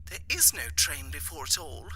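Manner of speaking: a lady explaining over the line; voicing the wife, light and soft; wife on the phone. Wife on the telephone.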